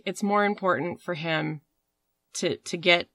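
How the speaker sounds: ordinary talk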